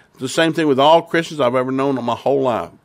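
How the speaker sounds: usual speaking voice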